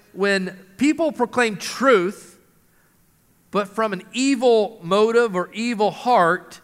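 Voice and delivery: ordinary talk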